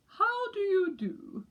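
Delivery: high, slow and proper; polite father penguin. A narrator's reading. Father penguin greets England, slow and posh-mannered. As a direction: greet like a genteel Englishman. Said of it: "said Mister Pilchard Brown".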